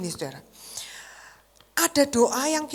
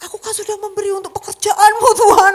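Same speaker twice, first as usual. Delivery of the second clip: mock bargaining prayer; 10 semitones higher, 12 dB louder than usual. Shown as a demonstration. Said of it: "Dia nggak pakai kata-kata".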